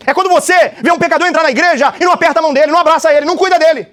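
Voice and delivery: high, loud sermon build-up; fiery preaching. Acted. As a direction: preach it hard to the congregation. Preaching at full force. (pregação inflamada, alta e aguda)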